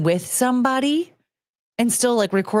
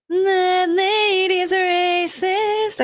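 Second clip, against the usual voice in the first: Disney princess; 8 semitones higher, much slower than usual. Pretend. Repeats the song.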